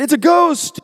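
high, loud frightened cry. Acted. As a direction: shout it in sudden fear. Disciples cry out in fear.

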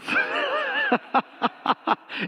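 a high, airy burst of laughter. Real, not acted. Laughs at his own obedience.

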